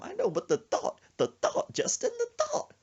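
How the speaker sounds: very high, hammy country panic; Gomer Pyle voice. Retold or imitated. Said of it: "he does that when he gets scared".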